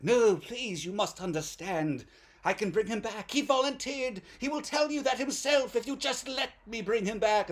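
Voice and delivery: high, pleading mad-scientist voice; hammed-up earnest begging; Boris Karloff. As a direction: plead theatrically with the police. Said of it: "Boris Karloff was like".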